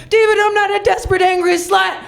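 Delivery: high, hammed-up furious protest; indignant shout. Woman confronting David in his office, indignant shout. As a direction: shout it as you burst through the door. Kicks down David's door, yells.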